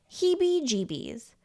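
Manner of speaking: usual speaking voice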